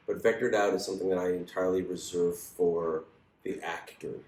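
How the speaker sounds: usual speaking voice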